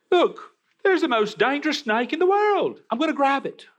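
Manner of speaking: high-pitched, hyped-up Australian wildlife host; excited Australian accent. Acted. Preacher imitating the crocodile hunter spotting a deadly snake, excited Australian accent. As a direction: exclaim it in a thrilled mock Australian accent. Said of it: "in that excited Australian accent".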